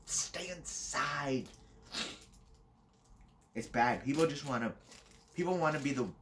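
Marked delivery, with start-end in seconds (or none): 0.0-1.5 high, drawn-out mock wail; mock scolding outcry. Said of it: "People are being like".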